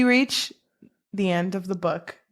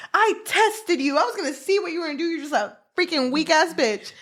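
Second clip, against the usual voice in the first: scornful mother; 8 semitones higher, faster than usual. Retold or imitated. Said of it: "the mom is like".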